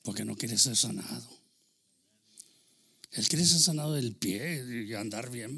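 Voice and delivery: usual speaking voice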